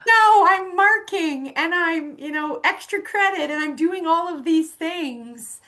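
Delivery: high, loud, hammed-up list of excuses; mock overwhelmed. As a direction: play frazzled and far too busy. Acts out overwhelmed friend's excuses.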